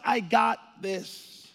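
slow, unhurried and settled; calm down a panicking son; calm reassurance. Acted. God reassuring an alarmed Jesus, slow and calm.

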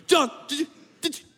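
high, loud indignant shouting; outraged disciples. Acted. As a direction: shout it like jealous followers betrayed. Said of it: "imagine the outrage".

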